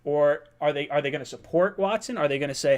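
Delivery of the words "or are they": ordinary talk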